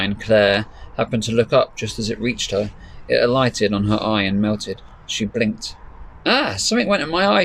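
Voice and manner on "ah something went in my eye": put-on higher girl's voice, a little pained; young girl startled by a snowflake in her eye; Claire's voice. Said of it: "she said".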